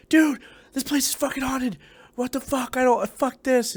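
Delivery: very high, freaked-out squeal of words; retold panic. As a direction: act out losing it, pitch way up. Retelling his panic to relief guard.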